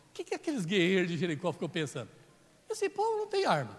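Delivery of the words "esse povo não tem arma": high mocking guard voice, scornful of the marchers; scoffing Jericho warrior. Warrior on the wall mocking the marching people, high scoffing voice. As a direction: play a sneering city guard looking down at unarmed marchers.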